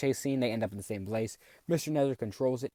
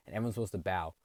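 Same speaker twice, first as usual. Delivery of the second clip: ordinary delivery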